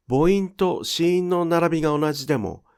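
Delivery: normal talking voice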